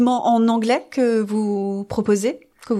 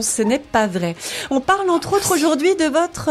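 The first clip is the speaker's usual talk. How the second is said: ordinary talk, nothing marked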